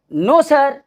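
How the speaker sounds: ordinary delivery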